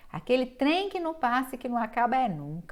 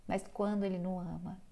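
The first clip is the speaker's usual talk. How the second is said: "Mas quando ele não ama" dropped much softer; hushed contrast; 2 semitones lower, 7 dB quieter than usual. Real, not acted. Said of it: "lecturer contrasting love and no love".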